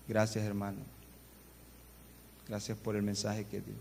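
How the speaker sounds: understated imitation of tearful gratitude; low, soft retold thanks; moved, tearful thanks. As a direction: quote a moved listener gently. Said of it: "una señora terminó llorando".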